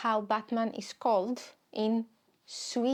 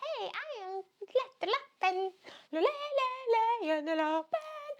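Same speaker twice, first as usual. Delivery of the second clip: squeaky high cartoon voice shouting Lederlappen; silly high voice; 12 semitones higher than usual. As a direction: pitch way up like a goofy cartoon hero.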